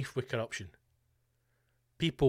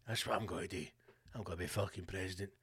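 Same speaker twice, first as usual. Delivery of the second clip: softer, muttered resolve to himself; low scheming decision, much softer; quiet mock Trump; 6 semitones lower, 9 dB quieter, faster than usual. Trump quoted deciding quietly.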